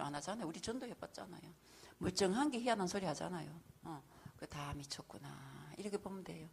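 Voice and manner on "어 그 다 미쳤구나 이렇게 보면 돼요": slow, hushed whisper. Real, not acted. A pastor leans in and whispers to the listener, hushed. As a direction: let it drop into a secret whisper. Confiding aside about everyone being mad.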